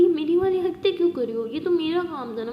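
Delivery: mock-indignant, up and loud; playful protest. Maya protests Aliya is stealing her teasing, loud and high.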